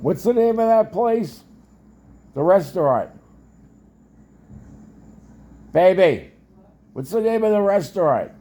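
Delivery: loud, high call to another room. Real, not acted. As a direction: call out to your wife in the kitchen. Calling out to wife in another room.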